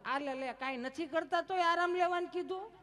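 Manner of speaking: high, loud scoffing surprise; mock disbelief. The mother-in-law scoffs that the daughter-in-law was told to rest, high and loud.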